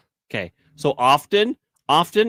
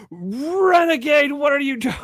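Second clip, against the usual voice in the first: shocked shout; 12 semitones higher than usual. Retold or imitated. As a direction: yell his name in disbelief. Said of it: "I'm like".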